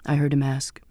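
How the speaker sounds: ordinary delivery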